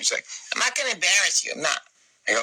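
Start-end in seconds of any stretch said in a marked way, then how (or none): 0.5-1.8 imitating an indignant mother, pitched high; high mock-offended denial; indignant protest. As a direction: protest in a raised, put-on mom voice. Mother's indignant reply, retold.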